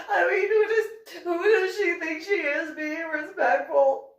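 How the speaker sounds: high, loud fake sobbing through laughter; a hammed-up wail of outrage; mock crying. Anna crying about the military project, high mock sobbing. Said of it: "I could see her just crying".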